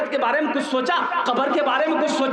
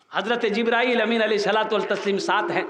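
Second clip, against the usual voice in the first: ordinary talk, nothing marked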